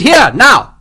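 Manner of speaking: loud high command; shouting. A narrator's reading. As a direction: call them over at once. Captain calling guard.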